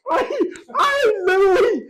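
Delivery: loud, high voice choked by laughter; real laughing-to-tears delivery; cracking up. Real, not acted. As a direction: struggle to get the story out while in hysterics. Talking through his laughing fit.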